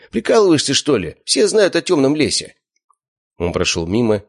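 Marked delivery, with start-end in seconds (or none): 0.1-2.6 higher, louder annoyed outburst; exasperated outburst. Acted. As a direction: blow up in irritation. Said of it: "Славик психанул".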